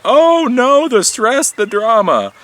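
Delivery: mock horror. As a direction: ham up the shocked onlooker. Mocking horrified tech newcomers.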